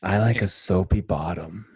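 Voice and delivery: low, slow cartoon impression; lightly voiced character, slow and deep; Joe from Family Guy. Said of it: "where Joe's like".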